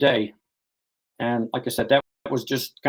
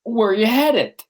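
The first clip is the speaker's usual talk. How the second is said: higher, louder call; calling into fog; 9 semitones higher, 4 dB louder, slower than usual. Retold or imitated. Hiker calling after the stranger lost in the fog, loud. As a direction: shout it out into the fog. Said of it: "I screamed into the fog".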